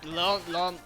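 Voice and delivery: usual speaking voice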